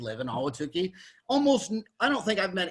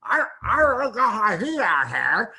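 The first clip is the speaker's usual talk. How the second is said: garbled, higher and louder by the end; hammed-up guy in an undershirt at his door; imitating scruffy homeowner; 4 semitones higher, 5 dB louder than usual. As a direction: blurt it out as a barely intelligible local. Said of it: "some guy in his wife beater shirt".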